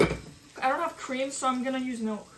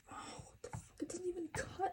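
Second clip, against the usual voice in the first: hushed, annoyed whisper; frustrated whisper; 7 semitones higher, 13 dB quieter, faster than usual. Real, not acted. Cook whispering annoyance at a blunt knife. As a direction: hiss the complaint quietly.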